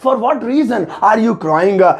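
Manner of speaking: each word drawn out slowly; very slow dictation. Shown as a demonstration. Teacher repeating the answer very slowly. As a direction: crawl through it for the class. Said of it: "इसे English में बनाएंगे".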